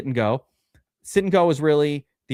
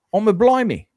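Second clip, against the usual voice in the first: high, loud mock English accent; intense English accent; 9 semitones higher, 6 dB louder than usual. Imitating someone in yesterday's movie, in an intense English accent. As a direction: put on an exaggerated English accent. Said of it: "the English accent was so intense".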